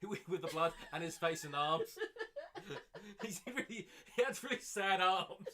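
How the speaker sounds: laughing through the words; can barely speak for laughing. Real, not acted. Cracking up at line.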